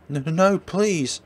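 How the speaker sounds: high, loud, frantic begging; terrified pleading. Acted. Girlfriend begging her attacker, terrified. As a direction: beg for your life. Victim begs before stabbing.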